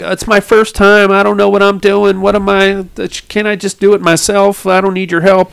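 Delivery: high, mock-protesting girl's voice; high-pitched mock girlish complaint; imitated flustered girl. Voicing shy girl protesting to friends.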